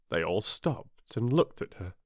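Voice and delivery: ordinary delivery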